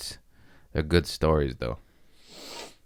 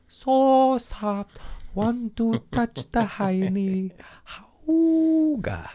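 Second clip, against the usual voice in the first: silly high voice; much slower than usual. Pretend. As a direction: coo at it like a giddy cartoon. Fondling soft item, silly voice.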